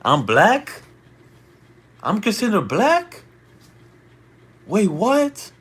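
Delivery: rising, stunned high-pitched questions; can't believe what he just read; shocked disbelief. Real, not acted. Streamer reacts to racist chat.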